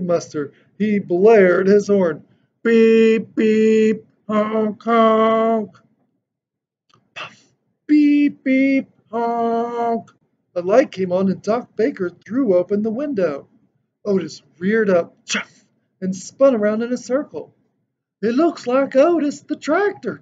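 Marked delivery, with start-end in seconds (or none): 2.6-10.1 loud, higher beep-beep honk sounds; horn imitation. A narrator's reading. Tractor blares horn loud. 18.2-20.2 put-on higher, different voice for the old doctor; Doc's voice. A narrator's reading. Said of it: "Doc said".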